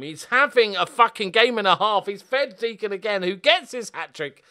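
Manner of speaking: loud, high joyful yelling; real excited outburst; excited shout. Real, not acted. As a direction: roar with delight at the goal. Star player scoring again.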